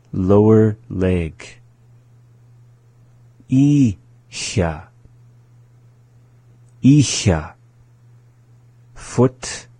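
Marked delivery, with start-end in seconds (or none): 3.5-4.9 ISHA in two slow parts, gap before SHA; slowly. Shown as a demonstration. Teacher going through the word for learners, slowly. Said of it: "go through the same process". 6.8-7.6 ISHA joined and short; up to speed. Shown as a demonstration. Teacher going through the word for learners, up to speed. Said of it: "go through the same process".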